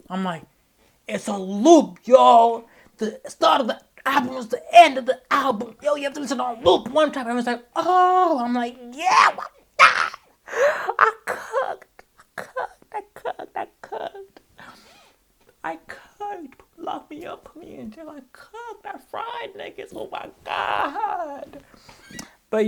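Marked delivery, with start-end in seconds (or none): 1.1-9.8 loud, high, escalating excitement; hyped up. Retold or imitated. Telling crowd the album loops, hyped excitement. Reenacts hyping the album on stage. 11.0-21.6 overwhelmed gloating. Acted. Marvelling at his own album, overwhelmed squeaky gloating.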